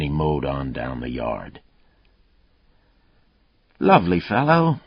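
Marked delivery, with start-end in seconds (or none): 3.8-4.8 a high, hammed-up fake English accent; mock English toff, dry and ironic. A narrator's reading. Partner mocking the rude suspect, fake English accent. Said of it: "in a fake English accent".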